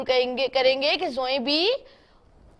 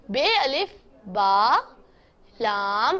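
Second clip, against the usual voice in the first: slow spelling; 4 semitones lower, much slower than usual. Shown as a demonstration. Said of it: "लेकिन इसको हम क्या पढ़ेंगे".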